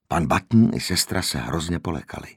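usual speaking voice

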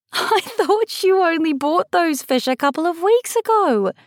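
high, laughing question; incredulous, giggly, high-pitched friend; amused disbelief. A narrator's reading. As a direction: laugh through the words in disbelief. Friend laughing at absurd goldfish story.

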